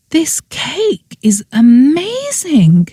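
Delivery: high, slow gushing delight. Acted. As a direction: savour the words. First bite of cake.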